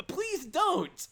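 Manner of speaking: high pleading cry; squeaky plea to stop; high panicked kid imitation. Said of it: "Keita's like".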